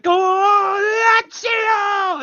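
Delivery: high, loud excited shout; kid cheering. Retold or imitated. Boy at home cheering Lazio on TV, shrill shout. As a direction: yell it like a little kid cheering on the telly. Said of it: "I was just running around going".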